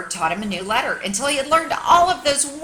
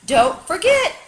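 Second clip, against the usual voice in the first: high, loud call, then slower; 4 semitones higher, 6 dB louder than usual. A narrator's reading. Bird calling back while flying away, loud call. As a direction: call down from the sky. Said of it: "she called".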